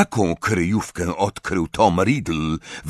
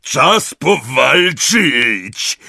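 loud, drawn-out winded excitement; big breathless giant voice; panting; 5 semitones higher, 4 dB louder, much slower than usual. A narrator's reading. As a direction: puff it out, eager for a fight. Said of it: "wydyszał Hagrid".

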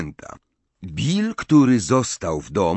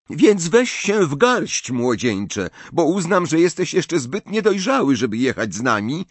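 angry warning; 8 semitones higher than usual. A narrator's reading. Mother warning her son he may stay behind, angry. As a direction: warn him sharply, every word weighed. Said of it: "powiedziała ze złością pani Wesley".

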